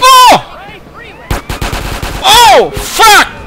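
high loud panicked shouts; panicked yelling. Real, not acted. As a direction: yell out in sudden panic. Panic as character dies.

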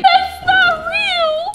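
very high, drawn-out wail; high-pitched disbelief. Acted. Guest faced with fake [name], high disbelieving wail. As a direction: stretch it out in a high squeal.

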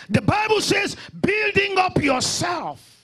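loud, high, drawn-out proclamation; full-force preaching. Real, not acted. Pastor quoting scripture to believers, loud proclamation. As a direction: proclaim the verse to the whole room.

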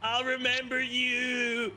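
hammed-up romantic goodbye, slowing at the end; mock tearful farewell. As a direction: bid a lost love farewell, stretching the last words. Mock farewell to a dying first love.

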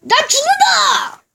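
high, slow drawn-out yell; hammed-up death cry; dramatic shout. Dramatic cry as dying in game. (과장된 비명)

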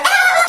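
very high-pitched comic shriek; short hammed-up scream; mock scream. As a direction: let out a sudden high squeal of fright. Mock scream after tempting fate.

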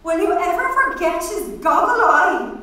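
high, loud, gleeful exclamation; chiming in with mirth. A narrator's reading. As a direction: exclaim it loudly for a giggling friend. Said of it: "chimed in deep bronze laughter".

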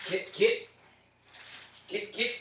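calling the cat. Real, not acted.

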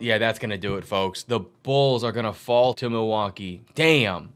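low, let-down sign-off; deflated resignation. Real, not acted. Commentator conceding the Bulls will fall, deflated resignation. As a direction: drop low as the hope goes out. Game lost after turnover.

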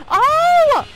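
high, loud startled yelps "Oh! Oh!"; startled shout. Real, not acted. Caught by the killer, yelping in loud alarm. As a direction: jolt out two sharp shouts of alarm.

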